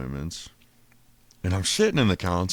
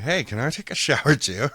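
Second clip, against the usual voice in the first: calling out; 9 semitones higher, 7 dB louder than usual. Retold or imitated. Calling over the jail intercom.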